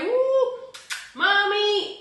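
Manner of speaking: hammed-up street catcall, sung high; mock catcall. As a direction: call out to a woman from the car window. Catcalling women from a car.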